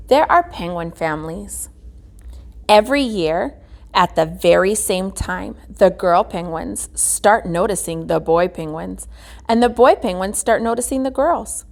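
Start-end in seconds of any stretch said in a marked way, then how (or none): none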